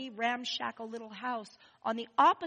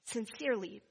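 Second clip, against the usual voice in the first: ordinary talk, nothing marked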